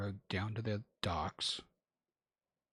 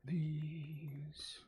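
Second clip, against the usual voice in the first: losing voice; 6 semitones higher than usual. Real, not acted. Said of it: "I can't believe I'm losing my voice".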